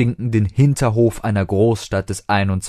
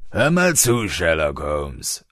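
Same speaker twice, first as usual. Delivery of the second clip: bouncer's low mutter; 3 semitones lower, slower than usual. A narrator's reading. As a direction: brush him off like a tough doorman. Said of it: "raunte der kräftigere". (raunender Türsteher)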